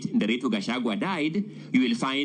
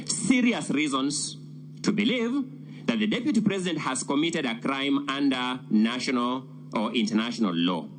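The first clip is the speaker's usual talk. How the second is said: ordinary talk, nothing marked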